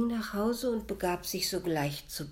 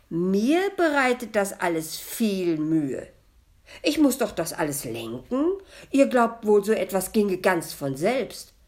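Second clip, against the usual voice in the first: loud, raised-pitch indignant ghost; offended; 5 semitones higher, 11 dB louder than usual. A narrator's reading. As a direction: protest loudly, thoroughly affronted. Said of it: "entgegnete das Gespenst beleidigt". (laut und beleidigt)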